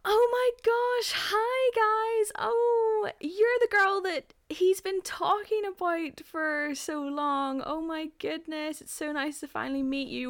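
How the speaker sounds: high, drawn-out squealed greeting; gushing friends. Retold or imitated. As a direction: imitate overexcited friends meeting the new girlfriend. Imitating gushing friend group greeting her.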